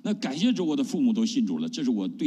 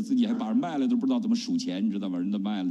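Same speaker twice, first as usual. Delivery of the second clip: usual speaking voice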